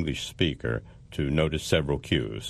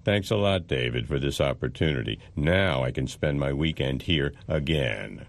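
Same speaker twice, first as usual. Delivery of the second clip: sarcastic. Acted. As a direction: voice going up and down in mock gratitude. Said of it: "Your tone of voice changed".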